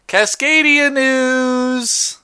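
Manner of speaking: slow, high, stretched-out announcer voice; mock announcer. Host jokingly announcing the Cascadia segment, stretched high. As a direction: draw out the segment title like a jingle.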